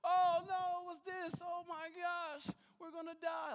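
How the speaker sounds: mock panic. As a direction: squeal it like you're sure you'll drown. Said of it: "I can imagine being on the boat".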